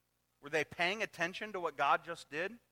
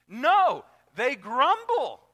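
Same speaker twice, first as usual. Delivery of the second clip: "they grumble" slow and loud; emphatic correction; 7 semitones higher, 10 dB louder, much slower than usual. Acted. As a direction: drag out "grumble" in disapproval. Preacher correcting ironic claim.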